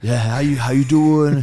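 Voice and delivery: light imitation of a mellow punk rocker. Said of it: "it's hard to not do it".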